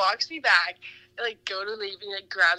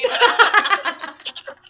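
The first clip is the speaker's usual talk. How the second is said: rising burst of real laughter; loud laugh; 9 semitones higher, 8 dB louder than usual. Real, not acted. Laughing loud with friends at the date story. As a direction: keep the big laugh rolling. Laughing, bar past hell.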